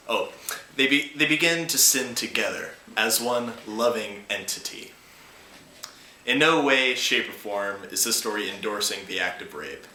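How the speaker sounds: usual speaking voice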